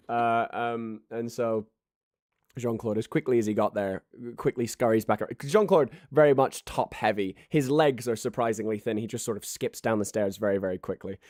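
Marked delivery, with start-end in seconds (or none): none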